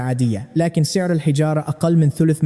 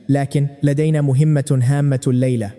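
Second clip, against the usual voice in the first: plain reading voice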